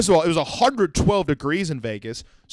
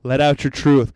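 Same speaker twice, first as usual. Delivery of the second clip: ordinary talk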